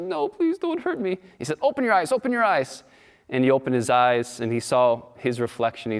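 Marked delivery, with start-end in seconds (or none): 0.0-1.2 high, small pleading voice; timid little sheep. Acted. Sheep lion begs at water. 1.6-2.8 high, firm urging lion voice; commanding lion. Acted. Lion urges sheep lion.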